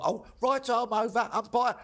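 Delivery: boy cricketer answering the umpire, lightly voiced; posh kid's voice. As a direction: chirp it like a polite under-eleven bowler. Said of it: "he doesn't do it in that accent".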